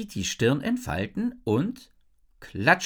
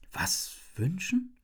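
slow, soft, raised questioning; quiet, puzzled repeat; 5 semitones higher, 5 dB quieter, slower than usual. A narrator's reading. Isabel repeats Jin's offer, quietly bewildered. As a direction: echo it softly and slowly. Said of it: "wiederhole ich leise".

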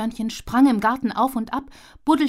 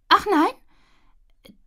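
high startled question; 10 semitones higher, 5 dB louder than usual. Acted. Startled squirrel asks Giesbert, high and sharp. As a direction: squirrel gasps in surprise. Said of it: "Tilly sah Giesbert erschrocken an".

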